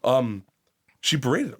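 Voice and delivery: ordinary talk, nothing marked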